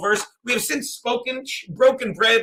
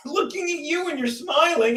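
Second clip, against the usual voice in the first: amused, laughing; 8 semitones higher than usual. Real, not acted. Amused at catching Keanu watching.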